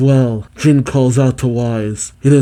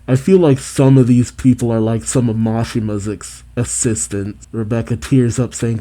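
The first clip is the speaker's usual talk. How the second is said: normal talking voice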